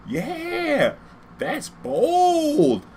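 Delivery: very high, squeaky exaggerated "yeah, that's bold"; street dude voice. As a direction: play a stranger marvelling at the bling.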